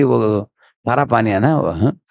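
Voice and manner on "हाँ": normal talking voice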